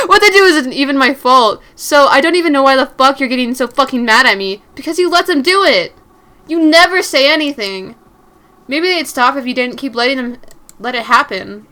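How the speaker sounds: loud furious shout at her; angry yell. Acted. Angry boyfriend yelling at Mary for coming home late. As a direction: yell it at her the moment she walks in. Argument continues.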